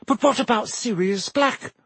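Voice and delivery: high, urgent voiced hiss. A narrator's reading. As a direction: press the warning in a high, tense hiss. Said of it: "Hermione hissed".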